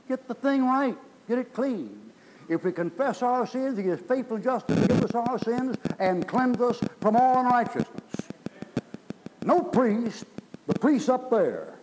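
high, loud pulpit proclamation; forceful preaching. Real, not acted. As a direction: ring it out to the congregation.

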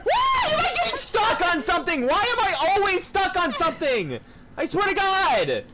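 very high, exasperated yelling; high-pitched gamer meltdown; frantic complaint. Real, not acted. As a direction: wail in frustration at being stuck. Stuck while a zombie attacks.

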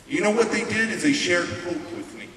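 normal talking voice

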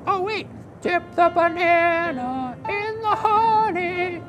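high excited puppet voice; squeaky eager kid puppet, bouncing with excitement; Nachman puppet voice. Acted. Puppet character speaks.

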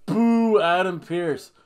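loud drawn-out jeer; playful loud boo; mock booing. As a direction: heckle him like a wrestling fan. Jeering another wrestling figure.

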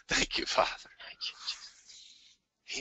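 barely audible, slow whispered thanks; whispered prayer. Real, not acted. Man closing a group prayer, thanking God in a whisper. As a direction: let the prayer fade into a whisper.

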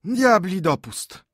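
put-on little old men sighing in dismay; heavy sigh. A narrator's reading. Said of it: "westchnęli ciężko tyci staruszkowie".

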